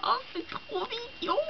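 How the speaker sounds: high, soft squeal at something cute; small high squeal of delight; delighted coo. Real, not acted. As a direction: coo it high and quiet, charmed. Squealing at cute cat bookmarks.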